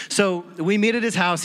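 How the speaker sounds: ordinary talk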